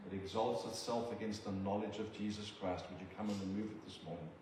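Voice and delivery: measured, reverent prayer to God; earnest prayer. Acted. Preacher praying over the church family, earnest prayer. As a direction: pray it humbly over the congregation.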